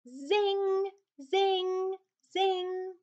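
high, ringing zing of a saw. A narrator's reading. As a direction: sing out the saw's zing. Saw sound read with effect.

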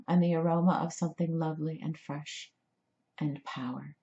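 usual speaking voice

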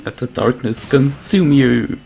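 slow, lightly voiced villain growl; half-hearted dark villain voice, slow; a hint of a menacing villain drawing out consume; odd put-on voice. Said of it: "I don't know what that voice was".

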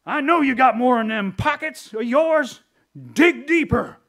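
loud, high, hammed-up scolding preacher; mock browbeating shout over the offering plate; berating. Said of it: "I berate you and say".

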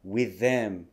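"with them" run together on one TH; linked TH sounds. Shown as a demonstration. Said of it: "くっついて".

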